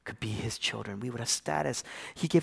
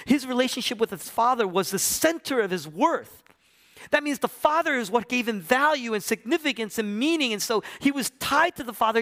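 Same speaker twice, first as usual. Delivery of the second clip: high, loud, urgent sermon delivery; impassioned pulpit climax; full-force preaching; 11 semitones higher, 6 dB louder than usual. Real, not acted. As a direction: preach it at the top of your register.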